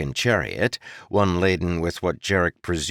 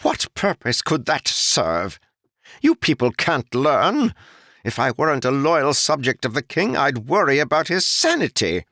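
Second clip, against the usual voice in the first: looking down on peasants, each word disdainful; scornful noble; 9 semitones higher, 4 dB louder than usual. Acted. Noble mocking the idea of teaching commoners, high sneering voice. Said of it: "the noble growled".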